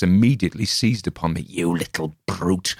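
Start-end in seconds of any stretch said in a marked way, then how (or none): 1.4-2.7 slow bleat, teeth ground; bleating through teeth. Acted. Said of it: "he bleated, grinding his teeth".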